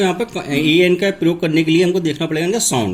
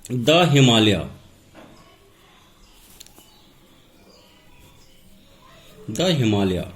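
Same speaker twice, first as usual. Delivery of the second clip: द stressed in "द Himalaya", said slowly; 5 semitones lower, much slower than usual. Shown as a demonstration. Teacher to students, slowly stressing द before Himalaya. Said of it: "हम हमेशा use करते हैं द".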